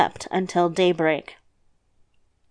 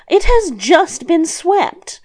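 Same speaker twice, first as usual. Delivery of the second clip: high, slow, emphatic scolding; scolding grandmother; 8 semitones higher, 4 dB louder, slower than usual. A narrator's reading. Grandmother to the devil about the swept house, scolding. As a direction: put on a higher voice and scold. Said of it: "His grandmother scolded him".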